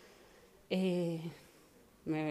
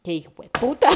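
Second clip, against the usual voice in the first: louder, higher, laughing as she swears; laughing curse; 11 semitones higher, 15 dB louder than usual. Real, not acted. Laughing as she looks back on her ex, half-swearing. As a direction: laugh out the insult. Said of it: "dije como".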